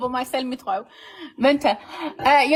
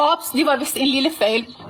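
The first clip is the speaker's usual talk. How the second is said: ordinary talk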